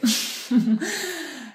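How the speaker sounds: high laugh trailing off; self-conscious laugh. Real, not acted. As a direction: laugh at your own stumble. Laughs at her own self-correction.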